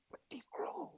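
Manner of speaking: retold stage whisper, high and breathy. Whispering to a friend so the star can't hear. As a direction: hiss it so he won't hear. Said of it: "But I'm, like, whispering".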